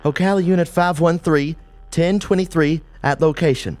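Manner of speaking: officer radioing arrival; radio call. A narrator's reading. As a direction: report into the radio crisply.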